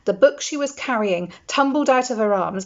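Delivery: ordinary delivery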